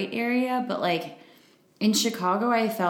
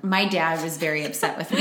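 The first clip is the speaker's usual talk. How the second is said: ordinary delivery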